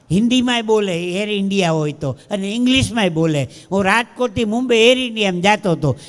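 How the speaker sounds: ordinary talk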